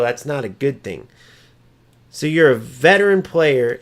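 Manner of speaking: normal talking voice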